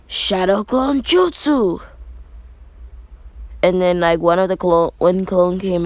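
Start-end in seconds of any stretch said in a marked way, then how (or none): 0.1-1.8 high, slow, drawn-out technique call; jutsu battle cry. Acted. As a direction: announce the move like an anime hero. Naruto casts his signature technique.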